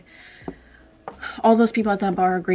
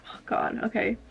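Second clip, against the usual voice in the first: breathy whisper, barely voiced; whispered resignation; 3 semitones higher, 7 dB quieter than usual. [name] whispers to herself after her boss sends her home, resigned. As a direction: whisper it to yourself, giving up.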